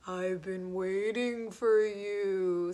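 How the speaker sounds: put-on a wise old sea creature greeting a guest, slowly; deep octopus voice. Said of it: "said the octopus with a deep voice".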